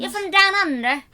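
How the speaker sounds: a bad Australian accent, played for laughs; mock Australian accent. Host tries an Australian accent for a fan from Australia. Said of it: "That's the wrong accent".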